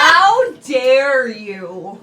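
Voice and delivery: slow, loud mock offence; mock outrage. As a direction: drag it out, pretend scandalised. Mock outrage over Meg.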